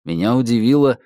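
ordinary delivery

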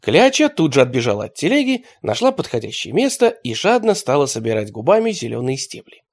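plain reading voice

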